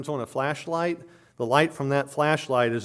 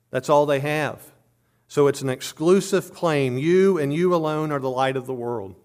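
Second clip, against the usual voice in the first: louder, higher, insistent pulpit preaching; forceful preaching; 3 semitones higher, 4 dB louder than usual. Acted. Preacher urging Christians that they alone light the world, forceful preaching. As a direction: press the point home to the congregation.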